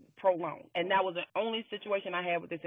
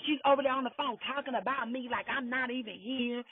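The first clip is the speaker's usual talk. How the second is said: ordinary delivery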